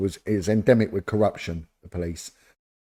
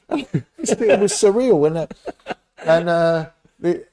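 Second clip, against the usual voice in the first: much higher and louder, slow, laughing through it; 9 semitones higher, 6 dB louder, much slower than usual. Real, not acted. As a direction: laugh helplessly at the memory. Laughing at absurd memory.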